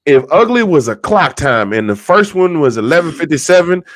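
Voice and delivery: normal talking voice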